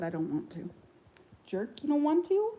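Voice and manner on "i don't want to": low, soft grumbly bird voice; lightly voiced sulky bird; mock parrot reply. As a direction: voice the sulky parrot, lower and quiet. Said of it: "He said".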